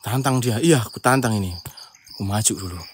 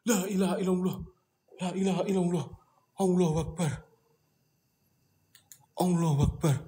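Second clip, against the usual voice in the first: soft murmured prayer; 7 dB quieter than usual. Real, not acted.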